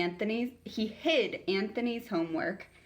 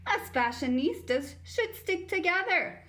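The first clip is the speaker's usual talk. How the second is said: much higher, bright and chirpy; bright girl voice; 12 semitones higher than usual. Acted. New girl chirping brightly to the bully. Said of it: "Carla continued brightly".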